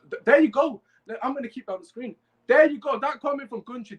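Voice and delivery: loud, high there you go; bursting with excitement; excited cheer. Real, not acted. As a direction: hype up the comment. Excited at comment.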